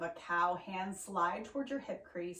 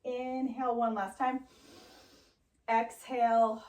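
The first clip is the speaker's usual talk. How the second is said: usual speaking voice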